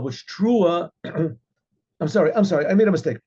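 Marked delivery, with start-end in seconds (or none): none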